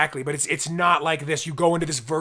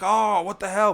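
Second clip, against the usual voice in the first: mild mock yell; 6 semitones higher, 4 dB louder than usual. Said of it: "You probably yelled at her".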